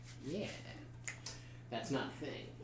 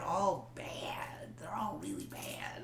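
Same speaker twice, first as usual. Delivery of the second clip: whispering; 7 dB quieter, slower than usual. Real, not acted. Admitting impressions are bad.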